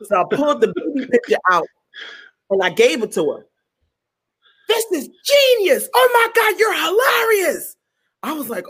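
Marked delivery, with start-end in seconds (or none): 4.7-7.8 very high, squealed delight; ecstatic raving. Retold or imitated. Producer raving over a baby picture, ecstatic.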